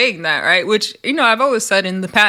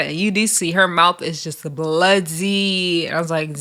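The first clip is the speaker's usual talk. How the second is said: normal talking voice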